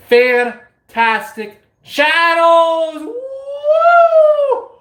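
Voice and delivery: very high, loud, slow whoop; stadium-style shout-out; cheering shout. Real, not acted. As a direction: hype them up.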